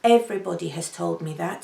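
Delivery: normal talking voice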